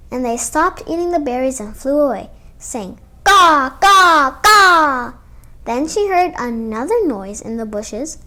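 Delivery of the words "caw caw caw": loud cawing crow; crow caws. Acted. Crows flying away from little Sal, loud cawing. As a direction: caw like a crow.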